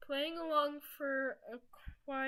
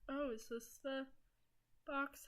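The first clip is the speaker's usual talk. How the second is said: usual speaking voice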